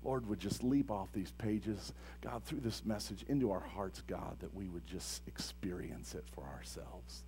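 soft, low, murmured prayer; hushed prayer. Real, not acted. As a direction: pray quietly, almost under your breath. Opening prayer before sermon.

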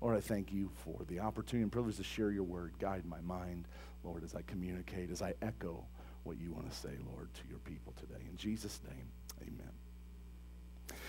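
soft, low, murmured prayer; hushed prayer. Real, not acted. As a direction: pray quietly, almost under your breath. Opening prayer before sermon.

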